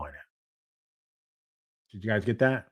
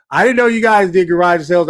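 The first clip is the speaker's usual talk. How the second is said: higher, louder burst of surprise; surprised; 5 semitones higher, 7 dB louder than usual. Real, not acted. Host reacting to viewers' news about New Zealand garage sales, surprised. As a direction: exclaim it, genuinely caught off guard.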